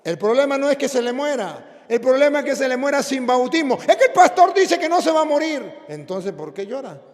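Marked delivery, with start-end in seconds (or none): 3.9-5.8 much higher and louder woman's voice; insistent mother. Retold or imitated. The mother answers.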